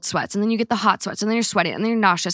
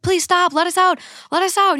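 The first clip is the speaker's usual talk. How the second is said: much higher panicked pleading; retold scream; 10 semitones higher than usual. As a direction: beg the driver to stop, terrified. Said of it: "We're all screaming".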